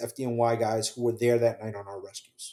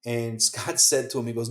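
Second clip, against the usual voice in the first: usual speaking voice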